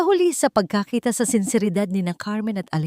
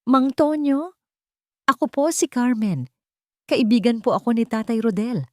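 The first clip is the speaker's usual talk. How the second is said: calling out; 7 semitones higher than usual. A narrator's reading. Nervous knock, calling at door.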